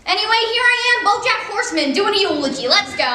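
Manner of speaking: loud, fast hype opening; hyped-up intro. Real, not acted. BoJack starting his mother's eulogy, hyped up. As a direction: pump up the room.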